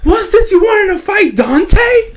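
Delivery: very high imitation of Katana storming in; mock outraged shriek. Katana bursts in on Luis about the fight, mock shriek. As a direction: screech it in exaggerated alarm, drawing out the end. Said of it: "Katana breaks into the door".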